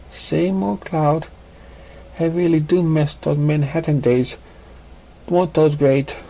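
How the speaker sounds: put-on cheerful old friend catching up; gentle female pony voice; young mare. Female character voice.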